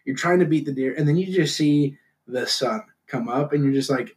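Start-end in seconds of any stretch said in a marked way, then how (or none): none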